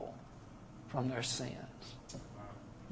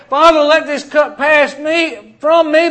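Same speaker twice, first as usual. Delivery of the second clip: loud, high Father, let this cup pass me; pleading cry; 9 semitones higher, 10 dB louder than usual. Retold or imitated. Preacher voicing Jesus pleading with the Father, loud.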